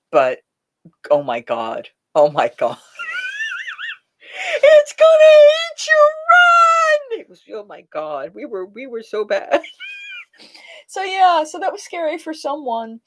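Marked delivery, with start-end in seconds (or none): 3.0-3.9 laughing. Real, not acted. Laugh after retelling. 4.6-7.2 very high, loud, crying shriek, slowed; imitating a panicked child. Host re-enacting the boy's warning to mom, high crying shriek. 9.7-10.2 laughing. Real, not acted. Laughing at memory.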